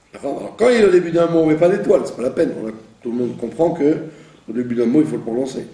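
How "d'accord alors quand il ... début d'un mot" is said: usual speaking voice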